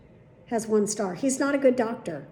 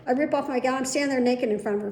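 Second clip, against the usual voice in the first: ordinary delivery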